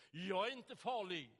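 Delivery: loud, high bellow; bellowing like a foghorn. Retold or imitated. Burly man roaring at preacher face to face, loud bellow. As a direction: blast it out like a foghorn. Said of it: "så vrålar han igen som en misslur".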